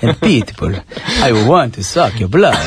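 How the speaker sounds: low, mock Dracula voice; vampire voice. Host teasing the co-host's delivery, low mock vampire voice. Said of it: "like a vampire".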